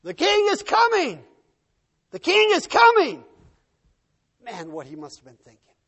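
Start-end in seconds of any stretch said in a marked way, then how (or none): 0.0-3.2 very high, full-force herald's shout; louder and louder yelling. Acted. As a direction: roar it as the army draws close. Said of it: "as it got louder and louder".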